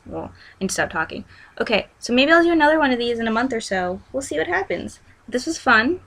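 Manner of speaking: usual speaking voice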